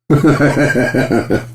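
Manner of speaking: high giggling burst; laughter. Real, not acted. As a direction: break into high chuckles. Laughing at own joke.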